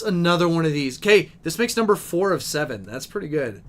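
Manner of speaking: ordinary talk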